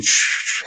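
hissed shh of a moving jacket; jacket rustle imitation. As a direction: imitate cloth noise with a sharp shh. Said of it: "like your jacket's moving".

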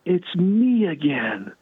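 mock scream, long held vowels; imitated joyful scream. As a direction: retell her screaming, stretched out slow and high. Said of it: "then she starts screaming".

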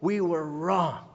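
slow, drawn-out deathbed line, barely voiced as acted grief; a hint of a dying old man, slow; dying Scrooge's last words. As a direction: let the confession come out slow and spent, like a last breath. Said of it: "He says".

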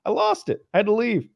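high, choked-up retelling; mock tearful. Telling friends about losing it at the video, mock tearful. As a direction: squeeze it out as if still crying. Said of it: "I was crying".

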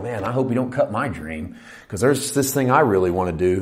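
low, soft anxious inner voice; hurt inner plea. Retold or imitated. Churchgoer privately fearing a dream gets cut, hurt inner plea. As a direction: voice a worried private thought, softly. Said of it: "hurt inside going".

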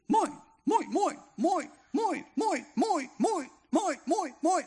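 high, loud squawking cries; a flock of seagulls squawking. Retold or imitated. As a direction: peck at the listener like greedy gulls.

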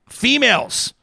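reading a caps comment as a yell. Retold or imitated. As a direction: shout the word out. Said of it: "I'm yelling 'cause it's in caps".

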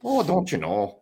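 very high, drawn-out mock Minnesotan accent. Podcaster teasing Minnesotans with co-host, slow high mock Minnesotan accent. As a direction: stretch out a hammed-up Minnesotan accent. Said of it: "Like, a true Minnesotian".

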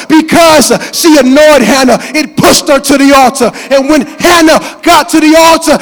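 loud, driving pulpit preaching; preaching at full force. Acted. Pastor preaching loud to the church about Hannah, full force. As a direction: preach it hard to the congregation.

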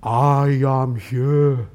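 very slow, low God voice; voice from heaven. Acted. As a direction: draw it out low like a voice from the sky. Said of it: "a voice from heaven that says".

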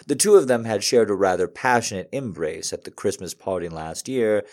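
usual speaking voice

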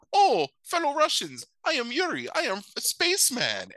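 high hammed-up Russian accent; a high mock Russian accent; mock Russian Yuri. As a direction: greet your comrades in a hammed-up Russian accent. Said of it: "he's like".

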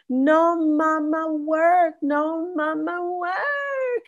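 put-on high, drawn-out toddler protest; very high-pitched little-kid wail of words; two-year-old toddler. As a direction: imitate a toddler refusing, last word stretched long and singsong. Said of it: "that's how she talks".

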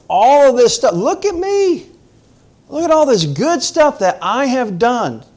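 a preacher mocking Paul's proud boasting of his good deeds; boastful Paul. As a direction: puff yourself up like a proud Pharisee.

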